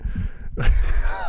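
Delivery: short airy laugh; burst of laughter. Real, not acted. Laughing again at the AK joke, breathy.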